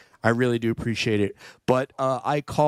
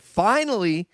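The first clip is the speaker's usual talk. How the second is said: high, loud burst of triumph; mock triumph; 9 semitones higher, 9 dB louder than usual. Real, not acted. Host celebrating finally saying a viewer's name right, triumphant. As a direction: crow it proudly, pitch leaping up.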